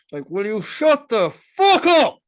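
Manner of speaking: loud, slow and seething; angry through teeth. Retold or imitated. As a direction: snarl it through clenched teeth. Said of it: "through my teeth".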